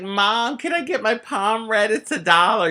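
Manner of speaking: put-on high, pleading kid voice; begging child. A nine-year-old asks mom for a palm-reading dollar.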